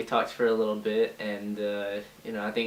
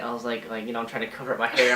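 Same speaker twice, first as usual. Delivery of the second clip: ordinary talk, nothing marked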